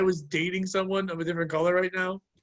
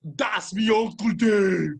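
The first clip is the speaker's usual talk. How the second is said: mock Jamaican; 3 dB louder, much slower than usual. Mock Jamaican uncle quote.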